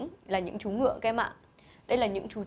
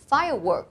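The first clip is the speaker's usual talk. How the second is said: Firework said "FIRE-work", first syllable strong; stress on first syllable; 3 semitones higher than usual. Shown as a demonstration. Teacher models word stress for pupils, clear stress. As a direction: lean on the start of Firework. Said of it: "trọng âm và âm tiết đầu và chú ý đọc âm cuối".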